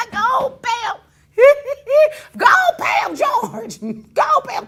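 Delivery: loud, high, laughing mock command; mocking shout. Mocking George into paying the fee, loud and laughing. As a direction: bark it through laughter.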